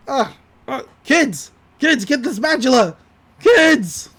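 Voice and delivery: high, straining mock yell; acted-out exasperated shout, building; calling for help. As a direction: holler for the kids as if stuck. Acts out stuck Stan calling kids.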